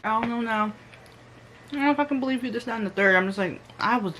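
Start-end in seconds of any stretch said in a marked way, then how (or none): 0.0-2.5 higher, whiny mocking voice of the friend; mocking imitation. Mocking the doubting friend on the phone, higher and whiny. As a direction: parrot her doubt back sarcastically. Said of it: "If you don't shut up".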